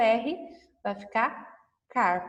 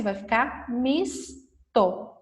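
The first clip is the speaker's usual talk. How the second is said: slow and clear; much slower than usual. Shown as a demonstration. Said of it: "Se eu completar com S".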